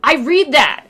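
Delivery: high, loud, slow amazement; surprised editor. Retold or imitated. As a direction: blurt it out amazed.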